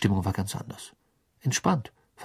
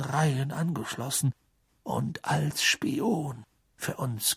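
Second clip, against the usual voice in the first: put-on calm old wizard; 7 semitones higher than usual. A narrator's reading. Dumbledore calmly testifying in court.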